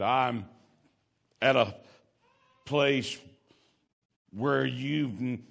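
halting dying man. Retold or imitated. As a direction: force out each word like a dying preacher. Dying man quoted speaking haltingly.

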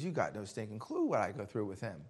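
low, quiet retold grumble; weary complaint. Preacher confiding about a troublemaker, fed-up grumble.

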